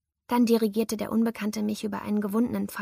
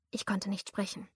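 plain reading voice

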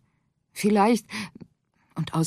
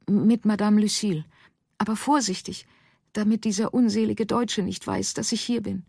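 anxious Madeleine; 7 semitones higher than usual. Acted.

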